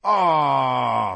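loud, drawn-out falling "ahh"; spoilt-fun groan, loud and long; disappointed chorus. A narrator's reading. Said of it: "All the boys chorused".

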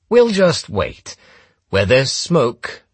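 slow, high, emphatic threat; drawn-out menace. A narrator's reading. Gunman makes his captive wait, slow menace.

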